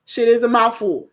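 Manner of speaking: ordinary talk, nothing marked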